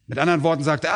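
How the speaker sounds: ordinary talk, nothing marked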